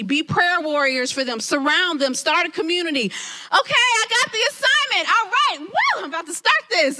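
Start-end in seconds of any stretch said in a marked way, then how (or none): 3.1-7.0 excited. Retold or imitated. Getting her ministry assignment, excited. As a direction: pump yourself up for the new task.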